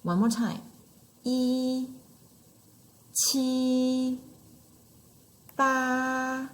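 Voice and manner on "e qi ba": "E, qi, ba" each drawn out high and level; first tone, high flat. Shown as a demonstration. Teacher repeating first tone one more time, high and flat. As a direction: chant "E", "qi", "ba" on the same high, unmoving pitch. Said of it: "That's our first tone".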